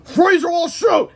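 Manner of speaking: guards shouting. Acted. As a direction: bellow it like armed guards. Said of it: "shouted the guards".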